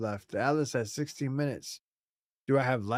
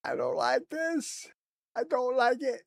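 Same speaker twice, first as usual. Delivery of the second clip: mock whimper; 11 semitones higher than usual. Gamer lost after the universe resets, whining in mock fear. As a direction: whimper like a scared child.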